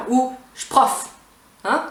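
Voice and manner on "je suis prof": "je suis" only a "ch" on "prof"; fully reduced contraction. Shown as a demonstration. Teacher shortening it for learners, reduced pronunciation. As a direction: say "ch'prof" as one syllable for "je suis prof".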